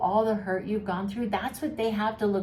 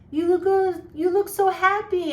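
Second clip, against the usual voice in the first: high, loud gushing praise; gushing commenters; 10 semitones higher, 5 dB louder than usual. Retold or imitated. Facebook fans gushing over the new couple, high and loud. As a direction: squeal it like a fan on Facebook. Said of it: "getting comments on their Facebook going".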